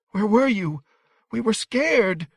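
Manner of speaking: put-on very high, plaintive boy's voice; scared child. A narrator's reading. Shivering boy asks rescuer where he was, scared. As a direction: ask anxiously in a high child voice. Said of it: "Between his clicking teeth, he said".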